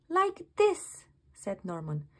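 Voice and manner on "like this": high, louder and eager. Acted. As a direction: show them proudly. Slug shows his plan.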